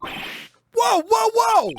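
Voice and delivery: high startled shouts; startled whoa. Acted. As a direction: shout it in sudden alarm. Startled character reacting in game.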